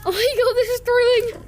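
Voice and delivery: breathless, laughing excitement; gasping between words; out of breath. Real, not acted. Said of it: "I'm out of breath".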